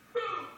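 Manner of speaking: high-pitched "boom" sound effect; sudden high boom; imitated impact. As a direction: mimic the blast with a high boom. Imitates light hitting the ground.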